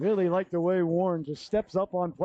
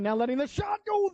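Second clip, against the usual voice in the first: rising to a sharp, much higher shout; excited shot call; 11 semitones higher than usual. Real, not acted. Play-by-play caller yelling as the shot is released. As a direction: sell the shot like it might go in.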